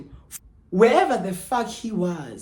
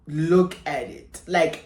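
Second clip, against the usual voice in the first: ordinary talk, nothing marked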